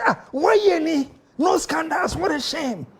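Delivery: high, fast mocking complaint; a lightly voiced friend moaning; mock NPP friend. NPP friend complaining there are no scandals, mock whine. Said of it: "uh, say".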